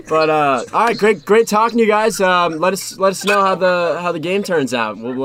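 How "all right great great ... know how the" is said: loud. Real, not acted. Loud sign-off to caller.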